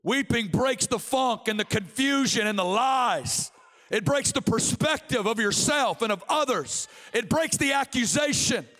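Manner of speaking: driving, declaimed sermon voice; ringing preacher's cadence; impassioned preaching. Real, not acted. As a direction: proclaim each phrase with mounting fervour.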